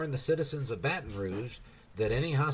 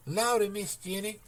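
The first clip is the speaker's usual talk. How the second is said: high, breathless maid's voice; gasped maid's cry; 9 semitones higher than usual. A narrator's reading. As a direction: gasp it out in a panic. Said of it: "she gasped".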